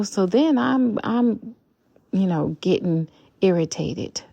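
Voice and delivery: ordinary talk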